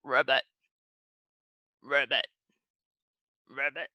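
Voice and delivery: low frog ribbits, spaced apart; frog croak. Pretend. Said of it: "What sound do they make?".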